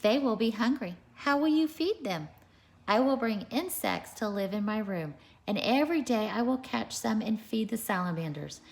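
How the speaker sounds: animated picture-book read-aloud; reading to children. A narrator's reading. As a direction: read it out to a class of little kids.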